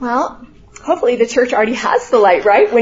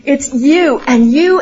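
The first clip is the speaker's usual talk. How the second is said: "you and you" slow, each "you" hit hard; stress on you; 4 dB louder, slower than usual. Shown as a demonstration. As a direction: stress both "you", slowly. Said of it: "it's very emphatic in the original".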